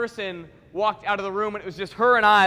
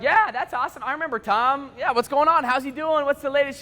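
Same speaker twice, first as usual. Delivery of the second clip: higher, upbeat string of questions; enthusiastic; 6 semitones higher than usual. Retold or imitated. Speaker hearing about an old schoolmate, enthusiastic. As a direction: gush with eager interest. Retold overeager reaction to news.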